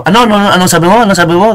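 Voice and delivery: high, pressing mock challenge; challenging debate retort. Shown as a demonstration. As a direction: fire the question back at a debate opponent. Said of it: "Ganun ako magdebate".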